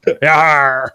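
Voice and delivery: growled pirate Yarr. Pretend. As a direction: give it a hammy pirate yarr. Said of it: "my awesome pirate voice".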